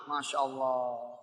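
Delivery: slow, soft, drawn-out awe; low reverent Masya Allah; awed murmur. Real, not acted. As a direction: breathe it out in wonder. Awed aside after big reward.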